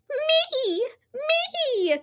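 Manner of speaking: very high eager cry; a little girl begging; cried. Acted. Polly begs to join story time, very high. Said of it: "cried Polly".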